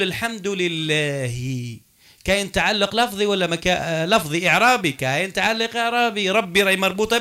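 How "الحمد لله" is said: very slow, lower Quran recitation; recited example. Shown as a demonstration. Teacher asking about grammatical attachment, very slow recited example. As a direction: stretch لله out with its final i. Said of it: "الآن لما نقول".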